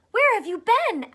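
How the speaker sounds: high, loud eager question; excited fish. Acted. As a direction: burst out glad to see a friend. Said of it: "asked the fish excitedly".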